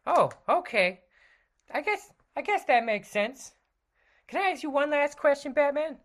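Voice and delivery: high, bright sidekick voice; Robin voice. Acted. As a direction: play the eager young sidekick.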